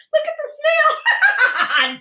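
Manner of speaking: slow, squealed words breaking into a mock belly laugh; pretend hearty laughing. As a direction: shriek it high through put-on laughter. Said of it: "we're gonna laugh".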